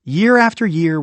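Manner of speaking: ordinary delivery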